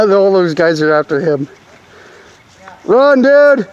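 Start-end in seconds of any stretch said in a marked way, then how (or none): 2.8-3.7 high, loud excited yell; shouting. Real, not acted. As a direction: yell him on to run. Shouting to fleeing player.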